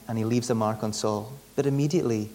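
ordinary talk

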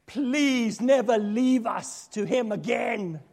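pleading congregant. Pretend. Congregation members begging the minister, slow and pleading. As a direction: beg them never to leave you again.

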